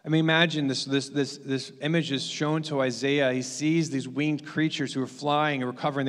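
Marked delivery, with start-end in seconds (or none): none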